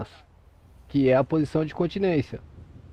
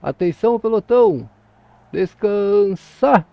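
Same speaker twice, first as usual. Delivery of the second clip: loud barked parade command, last word long and drawn out; military drill command; 3 semitones higher, 6 dB louder, slower than usual. Shown as a demonstration. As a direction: call it like a drill sergeant, stretching the final word.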